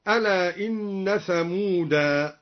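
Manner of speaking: ثمودا stopped on a long alif, "thamuda"; slow, end ثمودا on its alif; pause with alif. Shown as a demonstration. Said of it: "يقف بالألف". (الوقف على ثمودا بالألف)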